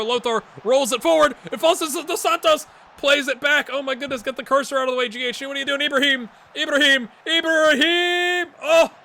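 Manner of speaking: high, loud play-by-play peaking in a shout; building excited commentary. Real, not acted. Gamer urges Ibrahim on in the attack, escalating high shouts. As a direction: build urgently to a long cry.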